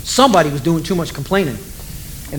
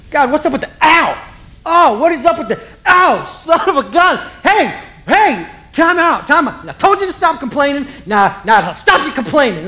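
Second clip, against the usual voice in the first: mock pained yelping; 8 semitones higher than usual. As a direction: ham up people getting bitten. Bitten Israelites yelping.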